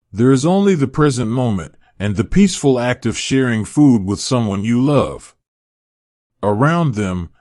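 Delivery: usual speaking voice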